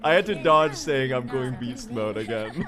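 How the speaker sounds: loud talk breaking into laughter; real amusement. Real, not acted. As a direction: laugh while owning up to the slip. Laughing at nearly saying catchphrase.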